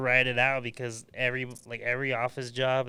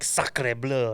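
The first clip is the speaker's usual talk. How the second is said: dramatic sacre bleu; 4 dB louder than usual. Pretend. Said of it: "at least for dramatic effect".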